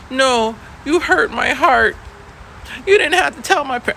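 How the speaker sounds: put-on high, hurt kid voice; mock wounded child; whiny student. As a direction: whine out the complaint. Student complaining.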